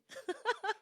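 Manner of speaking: real, high burst of laughter. Real, not acted. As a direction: laugh out at your own nerve. Laughing after "I'm not leaving.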